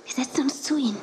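soft unvoiced whisper; hushed whisper in the studio. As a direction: whisper so the studio can't hear. Quiet in TV studio.

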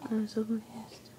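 slow hushed whisper; whispered aside. Real, not acted. Friend whispers her confusion during the video plan, hushed. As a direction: mutter your confusion under your breath.

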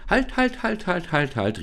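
mother calling them to stop, fading; urgent halt that winds down. Acted. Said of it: "rief da die Mutter".

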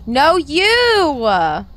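loud, high "no, you" with a drawn-out vowel; stretched, teasing yell; playful arguing shout. Pretend. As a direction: holler it back in a mock argument. Playful argument with Cat.

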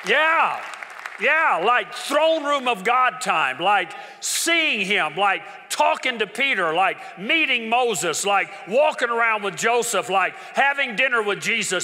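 loud, high and slow, hammering each line; rousing the congregation with joy; preaching at full force. Real, not acted. Pastor thundering to the church about heaven's joy.

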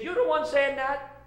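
high, loud and startled; startled shout. Acted. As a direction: blurt it out in shock. Burglar startled by the parrot.

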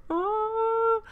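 high laughing squeal. Real, not acted.

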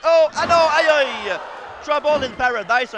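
high loud cry; excited shout. Acted. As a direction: cry out at the kick. Big kick called.

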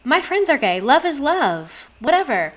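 high, airy mock voice; apolitical wife. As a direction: imitate a breezily tolerant wife. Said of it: "Someone that's like".

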